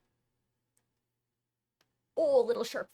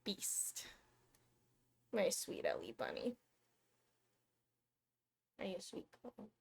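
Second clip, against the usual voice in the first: hushed cooing; 12 dB quieter than usual. Real, not acted. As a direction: murmur sweet names to the cat. Cooing to cat off-mic.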